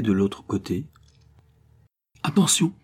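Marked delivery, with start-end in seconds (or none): 2.2-2.7 high, sudden call to look out; exclaimed warning. A narrator's reading. Young archer alerting his companions before shooting, exclaimed warning. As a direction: call out a quick alert, pitched well up. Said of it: "s'écria Robin en tenant une flèche".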